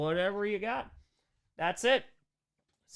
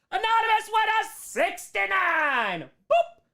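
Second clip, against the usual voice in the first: playful high announce; 5 dB louder, slower than usual. Pretend. Reading a donation playfully.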